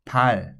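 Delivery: pal said clearly with an aspirated p; Korean word demo. Shown as a demonstration. Teacher models the Korean word for students, clear demo. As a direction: model pal slowly for the learner. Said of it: "The next word I would like you to try is".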